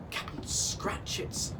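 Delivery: usual speaking voice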